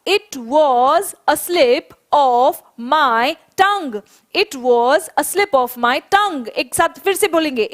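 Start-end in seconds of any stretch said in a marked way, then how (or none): 0.1-6.5 each word spaced out for the class to repeat; slow and deliberate, for the class to echo; teacher's slow dictation for the class to repeat; slow model sentence. Shown as a demonstration. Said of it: "सारे बच्चे एक बार मेरे साथ साथ बोलेंगे".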